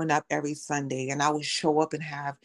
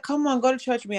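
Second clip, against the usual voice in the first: coaxing friend; 10 semitones higher, faster than usual. Retold or imitated. Friend pleading her to church.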